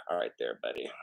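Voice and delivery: low breathy whisper aside; hushed aside to a buddy. Real, not acted. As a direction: whisper it as a private joke. Aside to a viewer.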